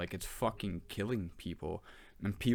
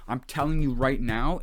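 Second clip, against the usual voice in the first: emphatic; 4 semitones higher, 8 dB louder than usual. Real, not acted. Emphatic plea to the audience.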